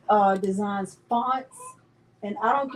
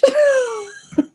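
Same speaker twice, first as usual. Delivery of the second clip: giggle; 8 dB louder than usual. Real, not acted. Giggles over her own name.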